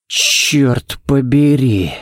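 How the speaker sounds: slow curse breathed out on the exhale; slow, breathed-out oath; exhaled curse. A narrator's reading. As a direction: let the words go out on a long breath. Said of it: "выдохнул Балок".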